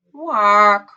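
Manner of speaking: one long, falling quack; drawn-out, dejected goose call; sad quack. A narrator's reading. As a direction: let a loud quack sag in disappointment. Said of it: "She was very sad".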